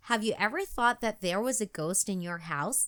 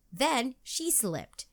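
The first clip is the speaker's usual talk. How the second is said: plain reading voice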